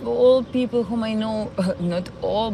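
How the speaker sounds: ordinary talk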